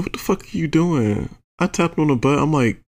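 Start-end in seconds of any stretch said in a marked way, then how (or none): 0.0-1.4 high-pitched, incredulous challenge; exasperated. Retold or imitated. Teller recalling a reaction to a partner, exasperated. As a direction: pitch it up in retold disbelief.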